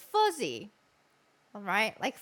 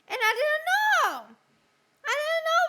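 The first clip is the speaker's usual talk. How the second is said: excited; 10 semitones higher, 4 dB louder than usual. Real, not acted. Storyteller exclaims.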